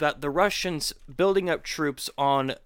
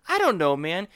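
ordinary talk

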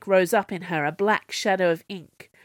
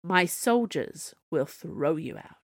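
plain reading voice

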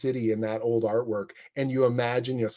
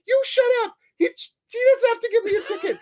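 very high, loud, crying shout; screaming driver; 5 dB louder than usual. Retold or imitated. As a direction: shriek it like a panicked driver. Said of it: "the person's like".

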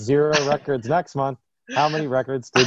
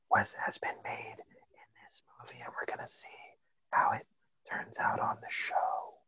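very faint, breath-only whisper; ASMR whisper; 2 semitones lower, 12 dB quieter than usual. Pretend. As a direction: whisper as if sharing a secret. ASMR sound effect segment.

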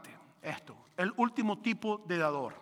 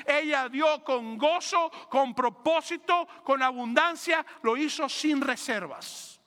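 slow emphatic; 4 semitones higher, slower than usual. Real, not acted. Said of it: "énfasis lento predicador".

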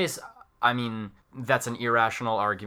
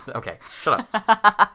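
playful; 11 semitones higher, 7 dB louder, slower than usual. Real, not acted. Teased by friend.

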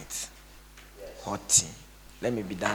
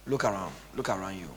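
usual speaking voice

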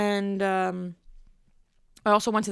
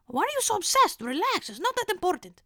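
high, put-on Mediterranean accent, dismissive; mimicking her Mediterranean dentist scolding her; 10 semitones higher than usual. Imitating her Mediterranean dentist brushing off the patient, high Mediterranean accent. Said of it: "Mediterranean or something".

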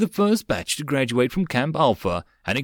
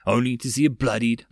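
usual speaking voice

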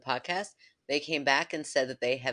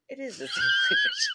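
high laughing outburst; laughing at herself. Real, not acted. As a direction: burst out laughing mid-sentence. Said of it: "I almost said same exact language".

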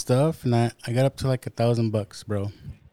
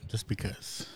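unvoiced whisper; whispered aside; 3 semitones lower, 13 dB quieter than usual. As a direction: whisper it under your breath. Repeats phrase as a joke.

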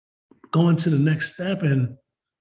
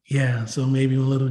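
ordinary talk, nothing marked

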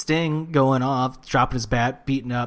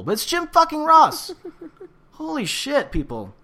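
exasperated outburst. Real, not acted. Wrestling fan defending Jim Ross to listeners, exasperated. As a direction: insist on it, voice shooting up.

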